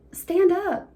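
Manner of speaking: high, loud call from the shore; mock yelled command. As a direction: shout a simple instruction. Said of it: "And they're like".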